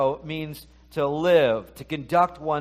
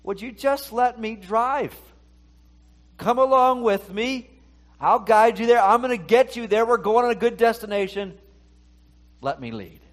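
high-pitched, insistent pleading invitation; Holy Spirit; 7 semitones higher, 4 dB louder than usual. Acted. Spirit asking the believer to let it drive, high pleading. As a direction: urge them along in a raised, higher voice. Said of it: "Holy Spirit's saying".

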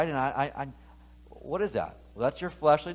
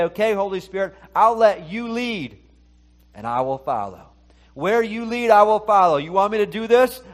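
raised, higher voice of willing commitment; emphatic surrender; 5 semitones higher, 6 dB louder than usual. Acted. Believer handing the lead to the Holy Spirit, emphatic pledge. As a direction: pledge obedience out loud, pushing the voice up.

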